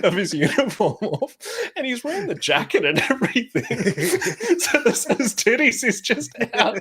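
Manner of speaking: high giggling through words; laughing. Real, not acted. Laughing while retelling.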